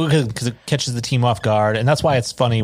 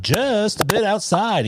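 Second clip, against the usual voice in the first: mock radio baseball announcer; drawn-out, high-pitched play-by-play impression; Harry Doyle; 10 semitones higher, slower than usual. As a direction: stretch it out high, then settle into the call. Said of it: "the way that Harry Doyle calls those baseball games".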